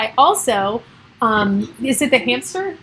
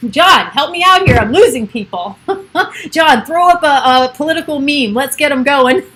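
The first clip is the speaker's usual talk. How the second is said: loud, higher call to a helper; mock plea for help; 4 semitones higher, 5 dB louder than usual. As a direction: call out in playful panic as the audience slips away.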